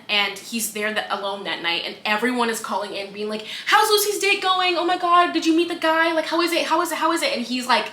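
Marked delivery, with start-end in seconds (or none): 3.7-7.4 higher-pitched volley of nosy questions; eager pestering callers. Retold or imitated. Callers asking the radio host about the date, eagerly pestering. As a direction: pester like a crowd of excited callers.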